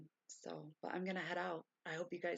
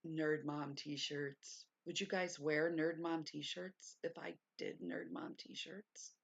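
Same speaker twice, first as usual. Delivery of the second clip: usual speaking voice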